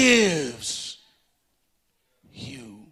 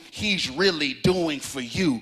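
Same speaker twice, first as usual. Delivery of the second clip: ordinary delivery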